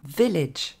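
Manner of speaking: VILLAGE as "VEL-edge"; English VILLAGE with two short "eh" vowels; stress on first syllable. Shown as a demonstration. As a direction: hit the start of VILLAGE, drop to short "edge". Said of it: "Mettez bien l'accent sur la première".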